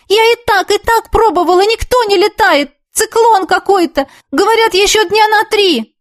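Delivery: high-pitched, flustered self-defence; agitated protest. Acted. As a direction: protest urgently down the phone line. Defending herself on the phone.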